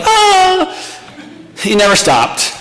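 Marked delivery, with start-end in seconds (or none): 0.0-0.7 high, piercing mock baby scream; laughing baby-cry imitation; imitated baby wail. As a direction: squeal it like a colicky baby at 2am. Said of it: "and this one's like".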